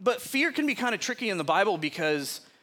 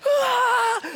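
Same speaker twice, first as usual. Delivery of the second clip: much higher, louder mock shriek through laughter; imitated shriek; 7 dB louder than usual. Mimicking his brother fleeing downstairs, laughing shriek. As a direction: yelp like the terrified brother, laughing. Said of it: "screaming for our mom".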